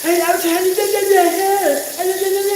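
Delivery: high, slow, fussy nag voice; mock nagging. Teasing Mister Willie about nagging, mimicking his high nag. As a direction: whine like a nagging partner. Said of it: "you left oil on the countertop".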